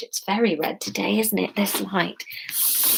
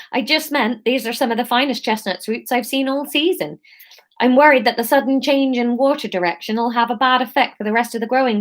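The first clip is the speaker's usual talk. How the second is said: higher Somerset seller voice; Somerset accent; 4 semitones higher than usual. Acted. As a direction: keep the Somerset burr going. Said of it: "this is gonna be Somerset".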